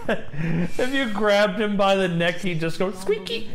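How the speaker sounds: giggling. Real, not acted. Still giggling about the cat.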